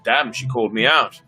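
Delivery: ordinary delivery, nothing marked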